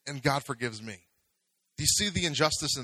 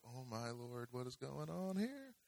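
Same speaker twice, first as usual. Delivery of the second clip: very hushed, drawn-out muttered dismay; softly mimicked shock, much quieter; mock bewildered congregation; 16 dB quieter than usual. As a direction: imitate a stunned onlooker under your breath, climbing at the end. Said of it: "I see a lot of".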